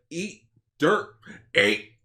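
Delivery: high, loud cartoon hero yell; Earthworm Jim. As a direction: bark it like a cartoon worm hero. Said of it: "Dan Castellaneta's Earthworm Jim".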